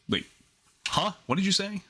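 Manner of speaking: startled high "wait, what?"; surprised. Retold or imitated. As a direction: suddenly caught off guard. People react to his remarks.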